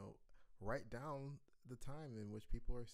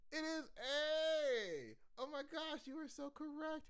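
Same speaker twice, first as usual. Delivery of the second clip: excited reveal; 4 dB louder than usual. Real, not acted. Teacher cheering the class for the right answer, high and excited.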